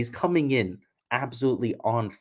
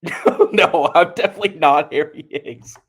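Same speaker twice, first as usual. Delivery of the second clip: laughing denial; 7 semitones higher, 8 dB louder than usual. Retold or imitated. Laughing denial to mistaken fan.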